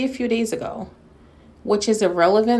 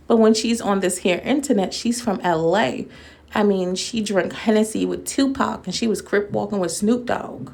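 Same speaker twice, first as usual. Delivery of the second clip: ordinary talk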